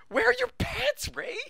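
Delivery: high, loud shocked squeal; shocked squeak. Acted. Reader voicing Mike's shock at Ray's pants, squealing high.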